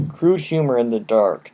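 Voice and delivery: plain reading voice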